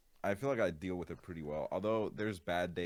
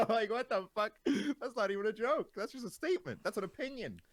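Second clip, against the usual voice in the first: very high, squeaky protest while cracking up; laughing incredulity; 4 dB louder than usual. Real, not acted. Streamer mocking a rude DM to a friend, laughing incredulity. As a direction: protest helplessly through laughter.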